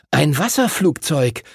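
leicht erstaunt, mit höherer Stimme; erstaunter Ausruf. A narrator's reading. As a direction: staunend ausrufen, ohne laut zu werden. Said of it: "rief Arthur erstaunt". (mildes Erstaunen, hoch angesetzt)

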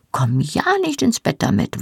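high-pitched, pointed warning. A narrator's reading. Wife keeps crumbs out of the bed, warning. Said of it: "warnte ich".

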